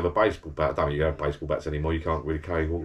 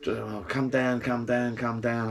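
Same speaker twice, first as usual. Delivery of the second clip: lightly raised, drawn-out pub mate calling upstairs; mate Richard calling up; 5 semitones higher, slower than usual. Retold or imitated. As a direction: coax a mate down from the bar, a bit higher. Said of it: "his voice sort of sticks out a little bit".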